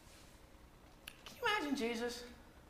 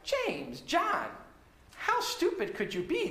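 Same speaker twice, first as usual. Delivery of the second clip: scolding; 9 semitones higher, slower than usual. Retold or imitated. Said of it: "They weren't indignant like".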